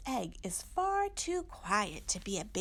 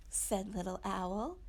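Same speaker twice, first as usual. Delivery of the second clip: plain reading voice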